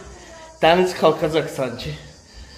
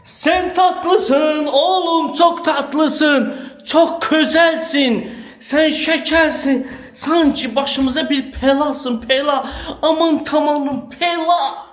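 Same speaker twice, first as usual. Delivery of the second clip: high, slow doting coo; baby talk; 9 semitones higher, 4 dB louder, much slower than usual. Acted. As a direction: gush over him with sweetness. Cooing over someone sweet.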